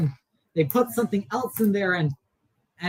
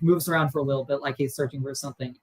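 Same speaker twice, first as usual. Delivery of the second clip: ordinary talk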